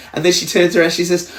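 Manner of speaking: ordinary talk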